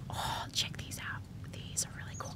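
soft, unvoiced-sounding whisper to the viewers; hushed whisper. Real, not acted. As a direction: whisper it like a secret. Confides excitedly about glasses to viewers.

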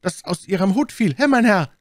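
put-on high, loud boyish shout; stable boy calling out. A narrator's reading. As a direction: call after a stranger as a stable boy. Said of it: "rief ein Stalljunge".

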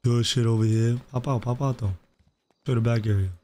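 smooth hustler character. Acted. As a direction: play a smooth-talking record label boss. Plays RP character Tay Cardi.

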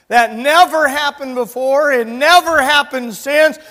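full-force preaching. Real, not acted. Preacher testifying to God's care, full-force preaching. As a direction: proclaim it to the congregation at full volume.

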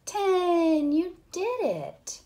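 high, loud triumphant cheer; big celebratory payoff after a hush; excited cheer. Real, not acted. Celebrates after the whisper count.

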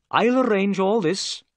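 a hint of a humbled inspector, polite; politely greeting the doctor, lightly voiced; Inspector Jones. A narrator's reading.

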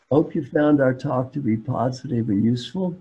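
usual speaking voice

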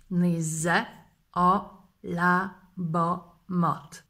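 lábamat drawn out very slowly. Shown as a demonstration. Teacher slows the phrase for students, very slowly. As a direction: stretch the last word for learners. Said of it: "slow split".

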